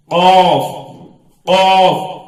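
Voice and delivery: full, rounded, drawn-out "قاف"; heavy letter. Shown as a demonstration. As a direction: model "قاف" heavy and held. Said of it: "یہ بھی موٹا حرف ہے".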